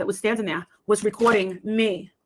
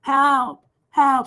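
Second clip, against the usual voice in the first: higher, louder mock cry for help; retold scream; 5 semitones higher, 6 dB louder, much slower than usual. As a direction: call out for help, re-enacting the scream. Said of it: "I started to scream".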